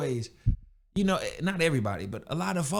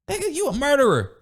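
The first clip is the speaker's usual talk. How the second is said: higher and louder, calling him out hard; exasperated outburst; 7 semitones higher, 3 dB louder than usual. Real, not acted. Ranting at viewers who defend a killer, exasperated shout. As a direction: let it burst out in disbelief. Ranting at excuse-making murderers.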